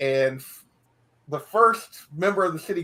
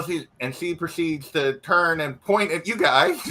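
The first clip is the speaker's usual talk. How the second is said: normal talking voice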